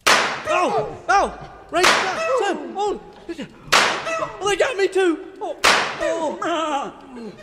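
comic cowboy hit by a bullet; hammed-up getting shot. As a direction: cry out like you've just been hit.

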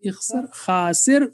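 normal talking voice

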